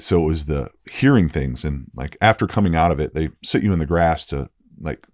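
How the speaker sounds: usual speaking voice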